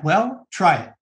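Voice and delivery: ordinary talk, nothing marked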